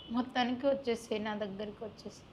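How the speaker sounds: ordinary talk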